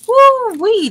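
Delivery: loud, high-pitched woo-wee; sudden gleeful holler; excited whoop. Real, not acted. Excited aside about Chicken Little.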